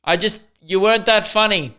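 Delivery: retelling a teacher's parting dig; imitating the teacher. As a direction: toss off the insult as the old teacher. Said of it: "he just turned around and went".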